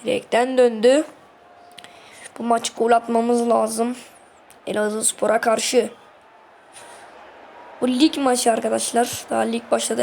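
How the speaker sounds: ordinary talk, nothing marked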